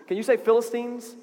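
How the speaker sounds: normal talking voice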